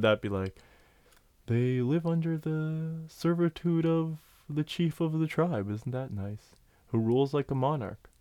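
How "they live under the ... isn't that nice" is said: slow, higher, gentle painter-turtle voice; Bob Ross turtle. Retold or imitated. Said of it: "I want a Bob Ross turtle".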